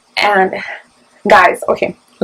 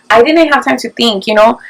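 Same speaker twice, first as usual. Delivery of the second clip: ordinary delivery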